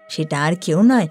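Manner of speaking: ordinary delivery, nothing marked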